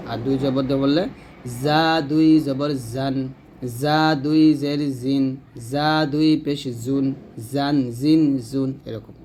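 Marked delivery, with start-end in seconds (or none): 1.6-8.7 letter drill with buzzed z for জা; chirring, sustained z in জা; buzzing za. Shown as a demonstration. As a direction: buzz each জান, জিন, জুন. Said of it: "পাখির মতো ঝি ঝি আওয়াজে".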